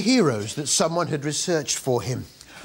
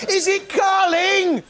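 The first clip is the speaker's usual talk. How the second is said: high, slow, wailing mock plea; hammed-up hope; 13 semitones higher, 3 dB louder, slower than usual. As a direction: beg it like a pantomime.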